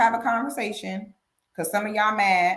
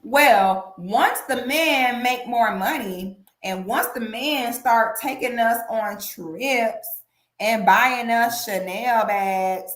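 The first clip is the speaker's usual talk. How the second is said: slow mocking; slower than usual. Shown as a demonstration. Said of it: "have this idea that".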